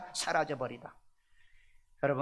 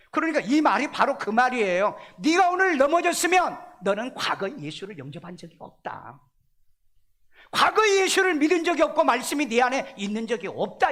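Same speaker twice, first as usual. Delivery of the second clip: louder and higher, driving the point home; emphatic preaching; 6 semitones higher, 5 dB louder than usual. Real, not acted. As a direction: preach it out with full force. (힘주어 외치는 설교)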